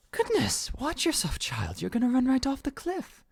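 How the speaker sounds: high-pitched, loud motherly fussing; alarmed mother. Acted. Mother picking up her child near cliff, high alarmed scolding. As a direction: cry out to a straying child.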